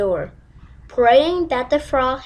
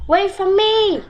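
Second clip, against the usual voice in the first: high loud plea; calling out; 8 semitones higher, 4 dB louder than usual. Acted. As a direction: call out to someone far. Frog chases Poppy.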